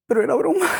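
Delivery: high mock-pleading protest, lifting at the end; retold whiny protest. Woman replaying her answer to the man who scared her, whiny protest.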